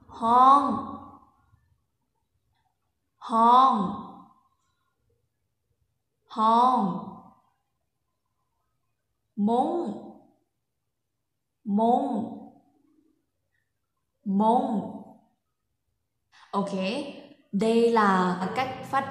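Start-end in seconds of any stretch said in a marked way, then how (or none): 0.1-7.1 "Hong" loud with a falling end; Lao mai tho tone. Shown as a demonstration. Teacher models the tone for students. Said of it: "có hai cách để phát âm". 9.4-14.9 "Mông" raised, then falling; Lao mai tho tone. Shown as a demonstration. Teacher models the tone for students. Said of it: "có hai cách để phát âm".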